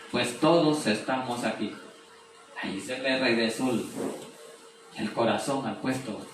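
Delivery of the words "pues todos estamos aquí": ordinary talk, nothing marked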